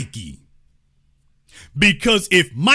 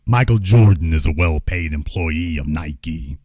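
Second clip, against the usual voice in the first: deep pitched-down secret voice; blurted confession; 13 semitones lower than usual. Acted. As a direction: let it slip in a low disguised voice. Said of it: "Oh, did I say that out loud?".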